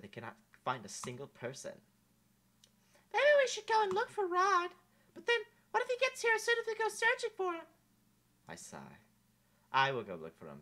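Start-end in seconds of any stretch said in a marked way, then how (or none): 3.1-7.7 put-on high, bright young princess, wistful; a lovely princess doubting fairy tales; princess Amelia voice.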